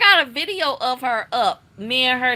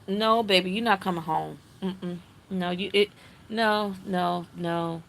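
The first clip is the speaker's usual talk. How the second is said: soft firm no; 5 semitones lower, 7 dB quieter than usual. Retold or imitated. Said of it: "And she was like".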